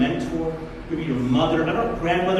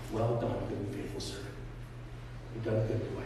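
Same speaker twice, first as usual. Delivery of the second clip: tender master's praise; 9 semitones lower, 13 dB quieter, faster than usual. Retold or imitated. Master softly blessing his faithful servant, tender. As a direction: speak it gently like a proud father.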